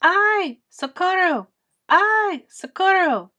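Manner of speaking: high, loud scream for help. Acted. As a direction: cry out trapped under a tree.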